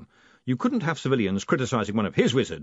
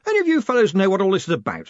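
high, loud and quick over a din; raised voice; 8 semitones higher, 5 dB louder, faster than usual. A narrator's reading. Lecturer asking fellow wizards over the din, voice raised. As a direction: call out over a noisy room. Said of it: "raising his voice above the din".

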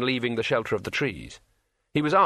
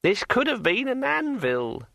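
high, slow, eerie ringing voice; like a finger round a wine glass rim; ringing metallic sword voice; 12 semitones higher than usual. A narrator's reading. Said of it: "in a voice like the sound of a finger dragged".

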